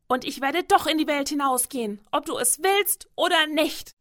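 put-on high, loud childish protest; defiant and loud. A narrator's reading. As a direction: shout back stubbornly at your mother. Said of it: "trotzig und laut".

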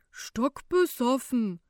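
slow, loud, disapproving grumble; grumbling older man. A narrator's reading. Older passenger judges collapsed man drunk, grumbling. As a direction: stretch it out in disgust, older man. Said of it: "murrt ein älterer Fahrgast".

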